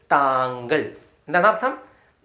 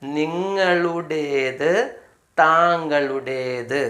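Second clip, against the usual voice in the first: slow drill; much slower than usual. Shown as a demonstration. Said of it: "അർത്ഥം എന്തായിരിക്കും?".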